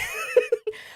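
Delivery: very high burst of laughter; squealing laugh. Real, not acted. Laughing at his reason.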